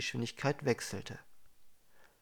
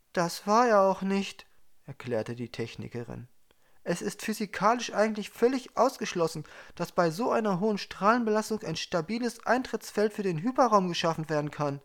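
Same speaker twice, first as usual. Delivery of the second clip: higher, louder, insistent technician; a tense female engineer, higher and louder; technician woman; 7 semitones higher, 4 dB louder than usual. A narrator's reading. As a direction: explain it as an agitated expert.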